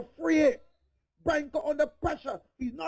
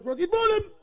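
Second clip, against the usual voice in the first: higher, lively play-by-play call; excited commentary; 6 semitones higher than usual. Acted. Commentator thrilled by the bowler's spell, excited call. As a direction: call the wicket with rising excitement.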